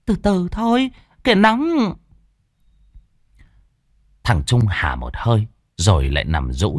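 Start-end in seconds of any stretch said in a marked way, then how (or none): 0.0-1.9 wife urging. Acted.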